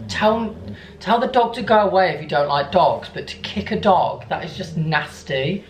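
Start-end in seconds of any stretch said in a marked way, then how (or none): none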